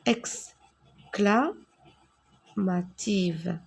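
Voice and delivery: syllabes très étirées; détache le mot très lentement. Shown as a demonstration. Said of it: "c'est une phrase". (exclamative étirée, très lente)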